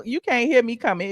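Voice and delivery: usual speaking voice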